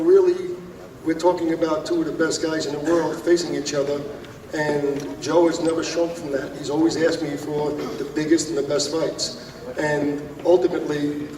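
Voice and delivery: ordinary talk, nothing marked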